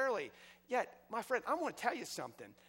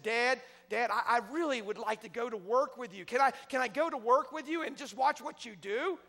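put-on eager little boy; 6 semitones higher, 6 dB louder than usual.

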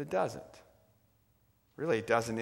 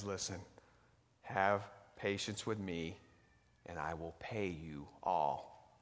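soft begging plea; 6 semitones lower, 11 dB quieter than usual. Acted. Fellow servant begs at his feet.

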